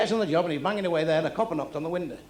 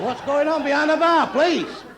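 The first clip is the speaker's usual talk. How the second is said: loud, higher call across the club; shouting at the bar; 9 semitones higher, 5 dB louder than usual. Real, not acted. Comedian yelling at bar staff over the crowd, loud. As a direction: bellow at the bar staff to stop the racket.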